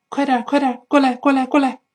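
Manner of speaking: high, hurried urging; urging someone on. Acted. Hurrying someone to come over, urgent high call. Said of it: "催催对方".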